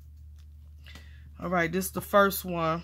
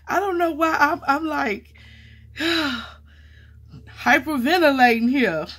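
nervous laughter; 7 semitones higher, 4 dB louder than usual. Real, not acted. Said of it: "I'm nervous now, hyperventilating".

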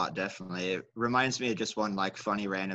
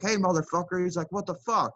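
angry trucker; 8 semitones higher than usual. Retold or imitated.

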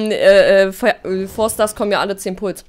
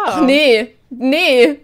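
scared; 4 semitones higher, 5 dB louder, much slower than usual. Real, not acted. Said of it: "Ich hab Angst vor Jumpscares".

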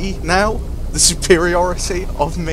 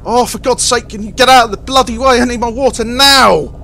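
impatient snapping in a higher put-on voice; angry; 4 semitones higher, 4 dB louder than usual. Harry snaps at his fireman to move. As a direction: shove the words out, fed up. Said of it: "looking angry".

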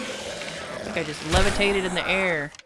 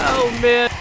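excited; 7 semitones higher, 12 dB louder than usual. Real, not acted. Reacting to the blast.

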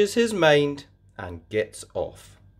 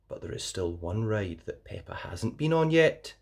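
usual speaking voice

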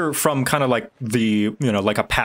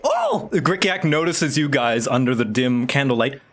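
normal talking voice

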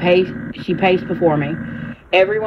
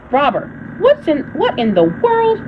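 bewildered Martha; 8 semitones higher than usual. A narrator's reading. Martha confused by pixie chaos.

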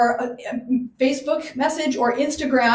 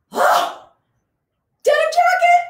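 delighted gasp; 5 dB louder than usual. Real, not acted. Spotting the teal denim jacket.

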